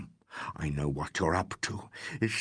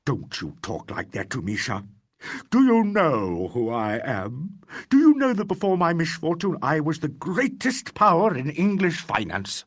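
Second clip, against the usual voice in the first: disgraced financier lecturing the detective with venom; venomous tirade; 3 semitones higher than usual. Acted. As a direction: look down on him and spit contempt. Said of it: "delivered with such venom".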